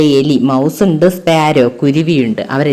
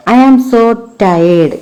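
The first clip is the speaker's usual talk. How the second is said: tired little sparrow; 4 semitones higher, much slower than usual. Acted. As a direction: raise the voice a little, slow and weary like a small bird. Sparrow speaks in story. (ക്ഷീണിച്ച കുരുവിയുടെ ശബ്ദം)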